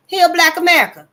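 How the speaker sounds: loud, high, forceful declaration; rallying cry. Real, not acted.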